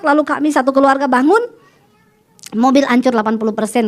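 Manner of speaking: ordinary talk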